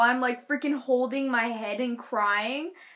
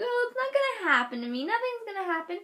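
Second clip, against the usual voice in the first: high, sing-song naive voice; mock foolish confidence; 9 semitones higher, faster than usual. Telling herself nothing will happen, mock naive and high. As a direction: imitate yourself being blithely stupid. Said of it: "I'm being really stupid right now".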